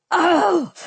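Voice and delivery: high, exasperated cry; screaming. A narrator's reading. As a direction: cry out in frustration. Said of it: "I screamed".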